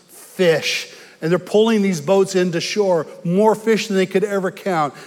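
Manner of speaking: loud, high-pitched preaching push; preaching at full force. Acted. Preacher driving the fishing story home to the congregation, fervent and loud. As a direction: preach it out with rising fervor.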